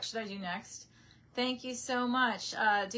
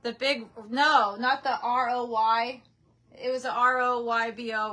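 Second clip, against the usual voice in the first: calling out; 2 semitones higher, 7 dB louder than usual. Real, not acted. Calling to father across house.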